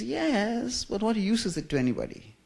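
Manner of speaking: lightly voiced blunt Dutch mother. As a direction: voice mom puncturing his joy. Dry mother deflating his dream.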